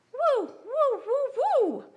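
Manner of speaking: very high, loud woo woo sounds; golden retriever noise. Retold or imitated. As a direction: bark like a happy dog. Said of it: "he sounds like a golden retriever".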